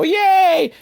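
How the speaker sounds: put-on high, loud cheer of kids; excited kids. Kindergartners excited by Dolph's plan, excited cheer. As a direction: cheer like a thrilled little kid. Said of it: "all the kids are excited".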